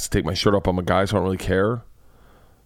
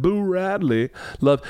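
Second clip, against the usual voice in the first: high slow Southern narrator; drawn-out Southern storytelling voice; old Southern accent; 9 semitones higher, slower than usual. Retold or imitated. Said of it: "Scout's voice in an old Southern accent".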